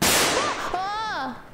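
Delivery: sudden startled yelp; a quick frightened shout; startled cry. Real, not acted. Startled in dark game.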